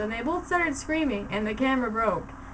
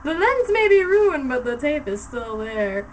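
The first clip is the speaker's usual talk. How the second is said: triumphant; 6 dB louder than usual. A narrator's reading. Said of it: "Lupin held up the camera triumphantly".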